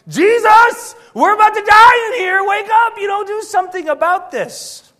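high, loud alarmed yelling at Jesus; panicked shout. Acted. Believers on a sinking ship crying to Jesus in panic. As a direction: yell it like the boat is sinking.

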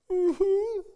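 high, breaking laughter. Real, not acted. Pastor laughing at his own story, high and loud.

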